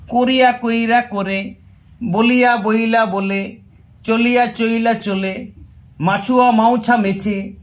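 slow, measured triplets stepping from কোরিয়া through কইরা to করে; stepwise sound change. Shown as a demonstration. Teacher demonstrating the stages of sound change, slow stepwise চলিয়া চইলা চলে. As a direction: recite each stage slowly, বলিয়া, বইলা, বলে. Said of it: "আরও ভালো উচ্চারণ হয়ে করে".

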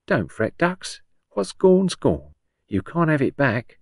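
high, lilting Cockney comforting; old Cockney woman. A narrator's reading. Patient tells grieving visitor not to fret, lilting Cockney. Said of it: "The old woman said".

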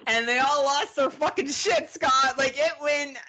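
high, loud climax of a funny story; loud, thrilled punchline delivery; worked-up excitement. Real, not acted. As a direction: tell it at full excited pitch. Climax of ghost tour story.

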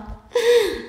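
quick high gasp of alarm; sudden high startled gasp; sharp gasp. Real, not acted. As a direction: suck in air at the near fall. Near miss on a jump.

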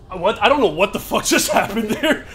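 real baffled outburst breaking into laughter; laughing disbelief. Real, not acted. Player reacting to a baffling hit, laughing disbelief.

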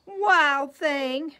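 mother calling her son. A narrator's reading. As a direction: call him sharply across the house. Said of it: "His mother called him".

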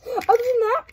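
silly high voice. Real, not acted. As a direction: jump way up into a goofy voice. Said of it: "I don't know why I'm doing this".

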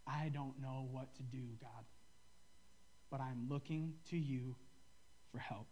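slow, soft plea to God; a hushed, halting prayer; quiet prayer. Real, not acted. A pastor praying softly to God for help, slow and quiet.

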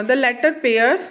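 slow, clear reading of an instruction. Acted. Teacher reading the book's instruction aloud slowly. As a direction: spell out the task slowly.

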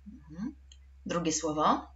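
normal talking voice

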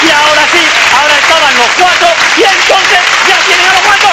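rising excitement, almost shouting; excited climax. A narrator's reading. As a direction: build to the big reveal. Climax of the story.

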